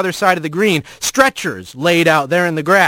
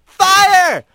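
high, loud alarm yell; 12 semitones higher, 4 dB louder than usual. Acted. Rat yelling a warning to the town, loud alarm yell. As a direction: shout it out to wake the town. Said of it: "he yelled".

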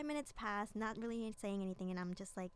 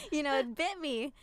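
laughing through words; 5 semitones higher, 8 dB louder than usual. Real, not acted. Laughing while telling rejection story.

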